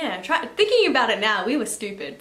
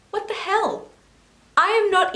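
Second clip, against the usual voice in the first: incredulous; 10 semitones higher, 4 dB louder than usual. Real, not acted. Disbelief at near kidnappings.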